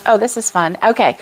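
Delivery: ordinary talk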